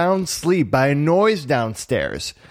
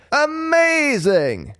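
high, long-drawn exclamation; amazed cry; 12 semitones higher, much slower than usual. A narrator's reading. Geppetto watching the puppet show, amazed. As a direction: stretch the word in high amazement. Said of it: "Geppetto cried".

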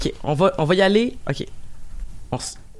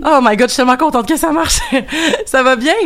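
excited; 7 semitones higher than usual. Real, not acted. Host relieved the phone call worked.

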